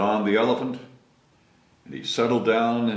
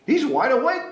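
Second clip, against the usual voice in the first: explaining giraffe; 14 semitones higher, 5 dB louder than usual. Acted. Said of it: "explained the giraffe".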